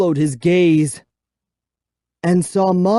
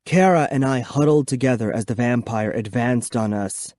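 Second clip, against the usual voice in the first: usual speaking voice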